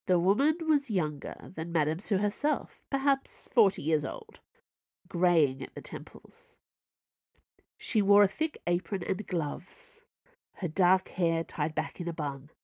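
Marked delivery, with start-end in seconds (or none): none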